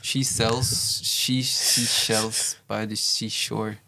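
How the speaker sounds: very slow, deliberate attempt; careful tongue twister. Shown as a demonstration. Player trying the tongue twister on the group's suggestion, very slow. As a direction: work through the tongue twister slowly, word by word. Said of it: "have a go at the tongue twister".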